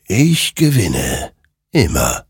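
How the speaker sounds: slow, weighty spectral proclamation; triumphant, drawn-out eerie voice; ominous rider. Acted. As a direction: declare it slowly, each phrase set apart. Rider claims every war.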